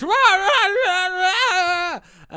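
high, very loud wordless yell; hammed-up screaming, drawn out; mock screaming. Podcaster imitating the woodsman bursting in, mock screaming. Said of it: "is just screaming".

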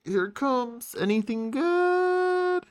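high, slow singsong question; excited. Real, not acted. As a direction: stretch it out, eager and high. Eager pack opening.

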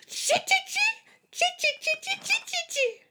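tiny, very high-pitched monkey squeaks; monkey chatter. A narrator's reading. Monkeys mimicking the scolding peddler, squeaky chatter. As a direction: chatter back like cheeky little monkeys. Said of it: "stamped both their feet back at him and said".

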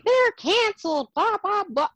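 high mocking whine. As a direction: mimic them, high and dismissive. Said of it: "y'all be saying".

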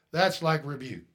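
normal talking voice

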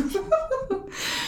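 real high-pitched laugh; high burst of laughter. Real, not acted. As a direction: squeal of laughter. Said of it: "poop American accents".

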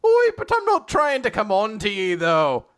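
high, loud Irish, dropping at the end; Captain Connor. Acted. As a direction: protest in hammed-up Irish.

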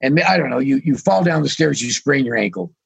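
ordinary talk, nothing marked

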